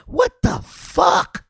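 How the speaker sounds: high, slow, drawn-out what the fuck; like you can't believe what you just heard; stunned disbelief. Real, not acted. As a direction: let the disbelief stretch the words out high and slow. Repeating the line in disbelief.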